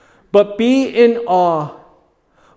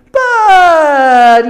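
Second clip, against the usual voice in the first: sudden high shout; sudden cry; 7 semitones higher, 7 dB louder than usual. Acted. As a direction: burst out with it. Pilate voiced, dramatic outburst.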